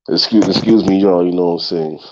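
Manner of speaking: ordinary talk, nothing marked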